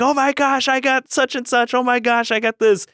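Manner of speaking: put-on high squealing kid delight; hammed-up childish squeal, way up high; mock excited kids. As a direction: gush like thrilled little siblings. Siblings excited opening gifts.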